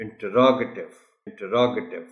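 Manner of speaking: interrogative with stress on ROG; correct pronunciation. Shown as a demonstration. Teacher repeats for note-takers, interrogative stressed on ROG. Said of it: "جن کو نہیں پتہ وہ note کر لیں".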